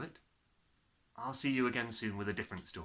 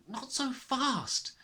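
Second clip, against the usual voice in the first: cautious teacher; 14 semitones higher, much slower than usual. A narrator's reading. Miss Honey voiced.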